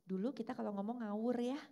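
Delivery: normal talking voice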